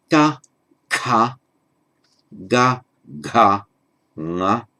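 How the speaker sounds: teacher drilling each syllable apart; Sanskrit consonant row. Shown as a demonstration. Teacher demonstrating Sanskrit sounds to students, carefully. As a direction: sound out ka, ka, ga, ga, nga one by one. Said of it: "So they go".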